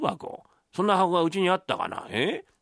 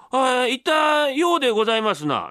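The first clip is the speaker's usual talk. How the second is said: high, hedging polite son voice; mimicked deferential reply, pitched up; imitating the young master; 6 semitones higher than usual. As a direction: bluff an answer in a put-on voice. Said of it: "なんだ、ようでございますってな".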